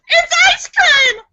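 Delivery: high, loud gasping cry; shocked gasp. Acted. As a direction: gasp then shout it in outrage. Penguin protests to mechanic.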